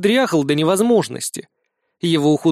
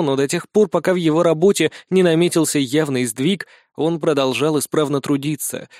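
plain reading voice

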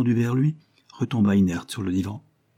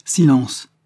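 loud, higher-pitched cry; shouted command; 5 semitones higher, 7 dB louder than usual. A narrator's reading. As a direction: cut the servant off loudly. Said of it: "cria Aldona". (ordre crié, fort et plus aigu)

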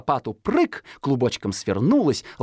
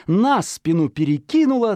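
ordinary delivery, nothing marked

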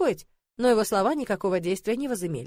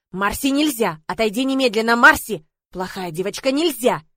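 firm, urgent commands to a naughty pet; scolding a pet; 6 dB louder than usual. A narrator's reading. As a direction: order the pet off, louder on key words. Owner scolds pet attacking narrator. (строгий окрик питомцу)

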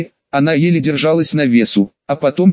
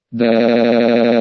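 a lower, breathy yes; 4 semitones lower than usual. A narrator's reading. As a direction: sigh the word out. Girl answers at climax.

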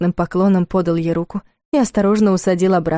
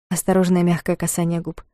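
plain reading voice

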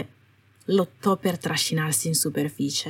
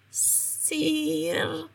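thin, high, very soft rasp of a voice unused for months; faint broken voice; feeble croak; 9 semitones higher, 8 dB quieter than usual. Acted. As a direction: barely get the word out, weak and high. Weak prisoner speaks after isolation.

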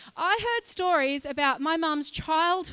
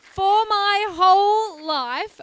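each word drawn out and louder; loud slow restart; 5 semitones higher, 10 dB louder, much slower than usual. Real, not acted. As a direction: reclaim the room, slowly and loud. Restarting opener over the room.

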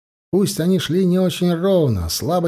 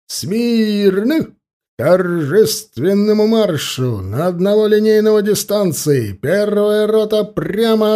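higher, slow barked parade commands; shouting drill sergeant; 6 semitones higher, 3 dB louder, slower than usual. A narrator's reading. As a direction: bark the orders across the parade ground. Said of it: "скомандовал Егоров".

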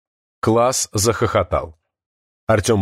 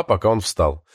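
usual speaking voice

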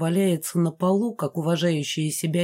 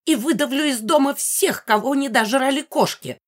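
high, carrying angry declaration; high-pitched ghost laying down the law; loud voice; 7 semitones higher than usual. A narrator's reading. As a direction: proclaim the threat in a high voice. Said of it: "послышался громкий голос Мегары".

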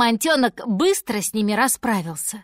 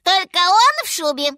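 high, loud monkey squeal; little monkey; 9 semitones higher, 7 dB louder than usual. A narrator's reading. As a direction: chip in as an excited monkey. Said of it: "добавила обезьянка".

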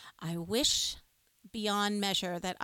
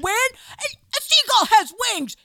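upset dad; 7 semitones higher, 10 dB louder than usual. Retold or imitated. Dad scolding mom, mock exasperated. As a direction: hammer the obvious at her. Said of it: "Dad was getting upset with Mom".

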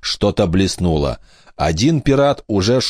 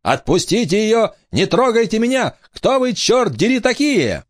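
frightened shout; 14 semitones higher than usual. Acted. Captive girl screaming at pirates.

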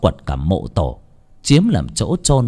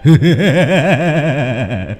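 long eerie cackling laugh; a ghost laughing at his terrified victim; ghostly laugh; 3 semitones higher than usual. Acted. Ghost laughs after threat.

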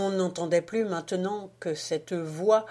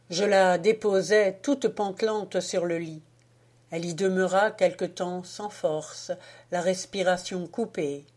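usual speaking voice

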